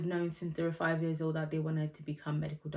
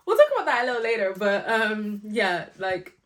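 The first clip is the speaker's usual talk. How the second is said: ordinary talk, nothing marked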